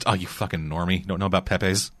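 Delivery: low, softly muttered mock insult; mock meme insult. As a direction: toss it off low and quiet, like a stock jeer. Quoting internet meme insult.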